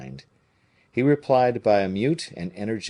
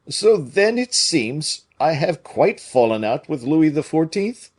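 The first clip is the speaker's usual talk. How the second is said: higher, louder worried musketeer; alarmed at his plight; Porthos voice; 7 semitones higher, 6 dB louder than usual. A narrator's reading.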